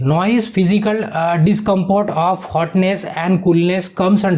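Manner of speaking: each English word read out very slowly; slow dictation. Shown as a demonstration. Teacher reads the question to the class, very slow. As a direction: spell the question out slowly for students.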